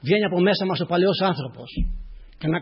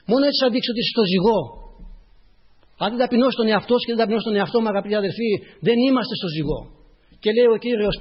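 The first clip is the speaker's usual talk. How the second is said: raised, emphatic sermon voice; impassioned preaching; 7 semitones higher, 7 dB louder than usual. Real, not acted. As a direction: preach it out with rising fervour.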